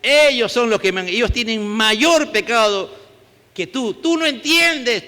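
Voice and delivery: impassioned preacher voicing Jesus; fervent preaching. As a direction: preach it hard, as Jesus speaking to Pilate. Preacher voices Jesus at full force.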